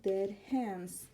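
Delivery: ordinary delivery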